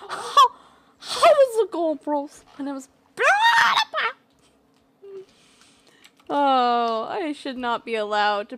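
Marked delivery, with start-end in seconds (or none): 0.1-4.1 much higher, shouted YouTuber greeting; PewDiePie impression. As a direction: hype it up like the YouTuber. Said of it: "dressed as PewDiePie".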